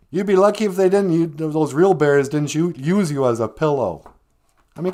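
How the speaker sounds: loud, high teasing fox voice, laughing at the little one; mocking fox. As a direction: scoff at him like a cheeky fox. Wagosh teasing Little Cutie.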